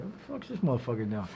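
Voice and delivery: low, quiet suspicious mutter under the breath. Retold or imitated. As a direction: mutter it to yourself, wary of the stranger. Suspicious thought about a stranger.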